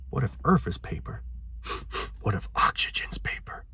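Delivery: hushed, breathy joke musing, trailing into a whisper; mock-awed hush. Vlogger joking to viewers that everything is paper, mock-awed whisper. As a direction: drop to a whisper as if pondering a silly revelation.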